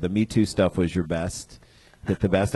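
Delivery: usual speaking voice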